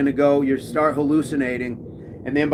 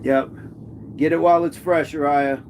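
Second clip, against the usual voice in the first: ordinary delivery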